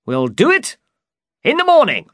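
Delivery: high, excited knight; loud storybook excitement. A narrator's reading. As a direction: leap up in pitch with eagerness. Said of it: "said Don Quixote excitedly".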